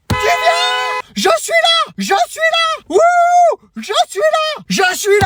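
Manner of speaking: shouting out. Acted. Calling out to son across street.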